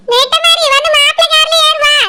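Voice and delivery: high-pitched motherly order; bossy mother. Acted. Mother ordering the bride into the groom's car, bossy. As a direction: order her along without raising the volume, pitched high.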